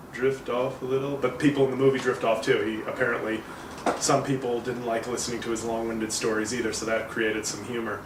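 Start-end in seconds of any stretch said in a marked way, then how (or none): none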